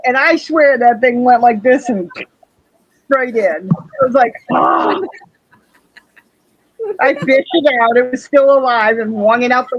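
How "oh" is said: voiceless mock gasp of horror; breathy imitated gasp; retold gasp. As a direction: act out a shocked intake of breath. Acts out shock as bug jumps.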